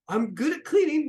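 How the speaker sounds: very high, loud little voice; eager crew member. Acted. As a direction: pipe up eagerly to the captain. Crew member eagerly offers a skill.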